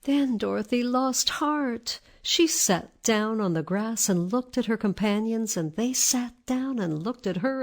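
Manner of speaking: ordinary delivery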